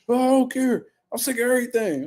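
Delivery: high, whiny, fed-up voice; mock whiny complaint. Imitating a fighter sick of it all, high whine. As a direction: whine it up high like you're over it.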